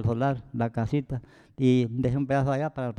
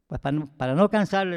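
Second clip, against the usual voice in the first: ordinary delivery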